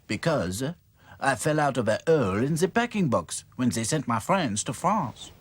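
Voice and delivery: lightly voiced French doll; a hint of a French accent. Claude reassures the dolls in French accent. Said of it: "explained Claude".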